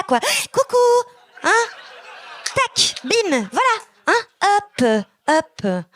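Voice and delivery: chirpy mock-Parisian patter, climbing in pitch; high-pitched French accent. Comic imitating her French-from-France self, high mock French accent. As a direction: parody French chatter, high with punched sound effects. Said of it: "ma voix fait juste monter un peu vers, vers l'aigu".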